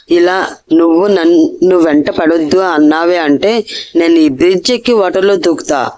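plain reading voice

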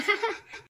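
high, sudden laugh; a quick real laugh at the dog; laughing as the dog sneaks up; burst of laughter. Real, not acted. Dog comes from behind.

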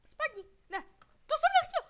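loud, high cry breaking into laughter; real panicked laughing outcry. Real, not acted. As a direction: shriek at your own mistake, laughing. Mistake in game, laughs.

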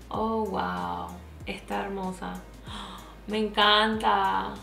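usual speaking voice

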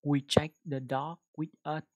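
We check the dot with us, read slowly and loudly; clear dictation. Shown as a demonstration. Teacher dictating the phrase slowly to the speech app. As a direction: say it deliberately for the app. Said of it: "Chúng ta sẽ đọc cụm từ này nha".

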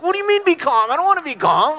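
high-pitched, agitated mock outburst; worked-up protest. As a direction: protest hotly, playing up your own irritation. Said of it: "I would get all like".

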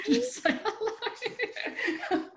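very high, slow coo at the dog. Real, not acted. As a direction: sweet-talk the puppy, laughing. Showing her dog on camera.

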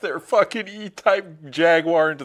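loud, high, drawn-out crowing; laughing victory boast; mock hype. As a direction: crow over the beaten car. Hyping the winning car, mock boast.

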